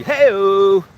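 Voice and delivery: loud cheerful hey-o; calling out. Real, not acted. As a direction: call it out across. Calling out greeting.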